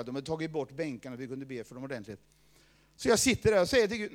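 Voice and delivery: ordinary delivery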